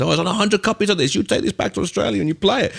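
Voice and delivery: put-on high-pitched, shoddy German accent; bad German accent. Techno hero handing over a rare bootleg, high fake German accent. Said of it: "Sorry, my German accent is shithouse".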